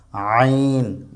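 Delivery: আইন squeezed from mid-throat, long vowel; pressed throat sound. Shown as a demonstration. Teacher models Arabic letter, pressed throat sound. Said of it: "চাপ দিয়ে পড়ব".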